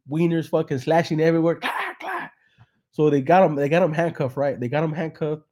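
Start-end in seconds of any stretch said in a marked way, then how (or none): 1.6-2.3 high, soft clack clack effect; clacking noise. Retold or imitated. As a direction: mimic the slapping sound. Imitated flopping sound.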